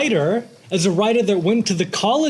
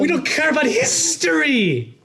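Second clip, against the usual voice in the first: Nick Cage rant; 9 semitones higher than usual. Acted.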